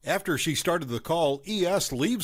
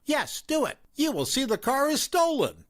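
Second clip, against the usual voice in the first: higher-pitched accusing mother; raised mom voice; 11 semitones higher than usual. A narrator's reading. Mom confronting the narrator at the fast food place, accusing. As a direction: play the suspicious mom, pitched up.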